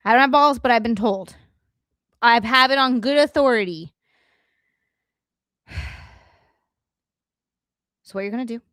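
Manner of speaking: normal talking voice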